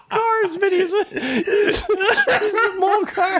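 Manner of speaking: very high laughing squeak; giggling. Real, not acted. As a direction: giggle through the words. Giggling while imagining a Molcar.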